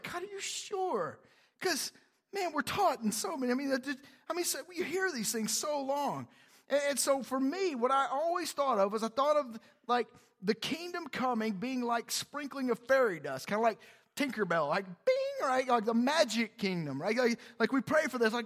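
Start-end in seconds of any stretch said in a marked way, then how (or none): 0.0-1.2 high, soft incredulous question; doubtful questioning. Retold or imitated. Preacher replaying his question to God, doubtful. 14.8-15.5 high, ringing "bing"; ping it high like sprinkled fairy dust; magic chime noise. Retold or imitated. Preacher imitating Tinkerbell's magic sparkle, high chime.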